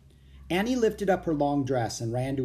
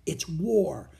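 breathy, higher, urgent stage whisper; 6 semitones higher, much slower than usual. A narrator's reading. Boy urging his sister to take danger seriously, whispered. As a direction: insist in a drawn-out whisper. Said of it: "Jack whispered back".

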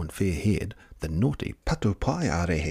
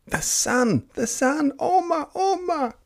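high panicked shrieks, much higher; shrieking fairies. A narrator's reading. Fairies seeing the sunrise, shrieking in high panic. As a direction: shriek it like frightened fairies fleeing the light. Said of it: "The patu paiarehe shrieked".

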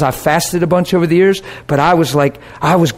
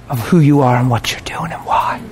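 whisper; 4 semitones lower than usual. Retold or imitated. God's quoted words, whispered.

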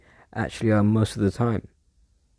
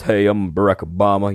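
booming Barack Obama; 4 dB louder than usual. Shown as a demonstration. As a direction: put on a big, booming strong-person voice. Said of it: "booming voice like this".

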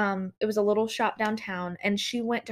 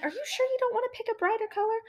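high, quick mock doubtful question; imitated sceptical tech; 11 semitones higher, faster than usual. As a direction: mimic a nail tech second-guessing a client. Retelling the tech questioning her choice.

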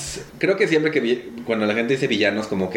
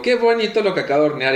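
delighted admiration; proud; 7 semitones higher than usual. Retold or imitated. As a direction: gush over the bake. Self-quote admiring baking.

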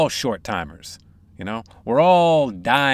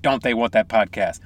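ordinary talk

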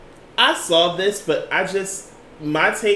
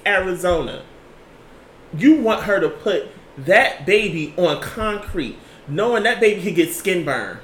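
loud, indignant rant with raised pitch; real indignation, louder and higher; heated outrage; 3 semitones higher, 5 dB louder than usual. Real, not acted. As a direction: argue it forcefully, hitting the key words hard. Outraged at police treatment of mother.

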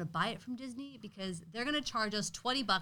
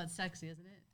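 usual speaking voice

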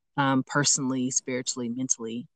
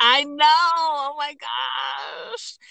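high, drawn-out squeal; real reaction, high-pitched and stretching out the last words; flustered exclamation; 12 semitones higher, much slower than usual. Real, not acted. Guest reacts to host's turned-around question, flustered squeal.

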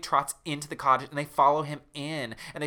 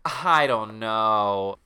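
slow Marissa voice; 3 semitones lower, 5 dB louder, much slower than usual. Acted.

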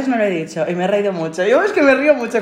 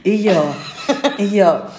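ordinary talk, nothing marked

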